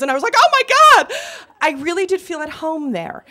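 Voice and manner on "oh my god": high, loud burst of excitement; ecstatic shriek. Retold or imitated. Contestant seeing the game show studio, ecstatic shriek. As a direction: squeal it out, overwhelmed.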